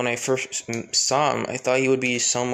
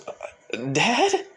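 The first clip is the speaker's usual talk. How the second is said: shocked recognition; 8 semitones higher than usual. Acted. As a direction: gasp out the word in disbelief. Daughter sees her father arrive.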